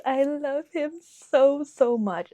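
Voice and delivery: high, loud, drawn-out gushing; besotted, emphatic fawning; adoring gush. Real, not acted. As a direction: stretch the sos, overflowing with love. Gushing over the Charmander plushie.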